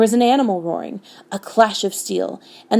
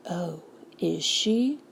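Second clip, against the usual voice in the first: very slow, hushed, sinister creature; soft, ominous drawl rising into a question; gray, terrible voice; 8 dB quieter, much slower than usual. A narrator's reading. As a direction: draw it out softly with menace. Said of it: "That gray and terrible voice".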